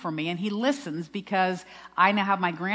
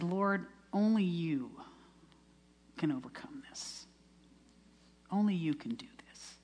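hushed prayer; 5 dB quieter than usual. Retold or imitated. Frightened bride's mother praying to the Lord, hushed. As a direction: retell a private prayer, nearly whispered. Retold desperate prayer to God.

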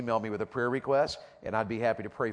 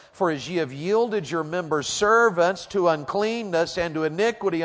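raised, emphatic pulpit reading; preacher reading scripture; 5 semitones higher, 6 dB louder than usual. Real, not acted. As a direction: proclaim the verses forcefully.

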